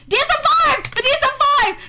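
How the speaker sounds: high, loud eureka cry; triumphant outburst; excited shout. Real, not acted. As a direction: shout it as you suddenly remember. Suddenly remembers the manual's name.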